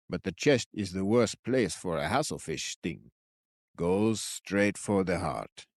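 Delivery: lightly voiced old man, slow and grave; a hint of an old stranger warning them. A narrator's reading. Old man voice, story figure.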